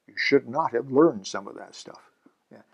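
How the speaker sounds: confiding whispered aside. Real, not acted. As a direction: lean in and whisper it.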